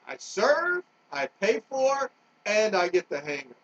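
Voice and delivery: rising fury at the unfairness; worked up. Real, not acted. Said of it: "I am just getting worked up".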